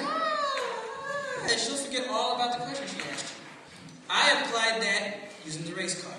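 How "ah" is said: very high mock squeal of delight; delighted wife. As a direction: squeal like the wife seeing a ring. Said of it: "she's like".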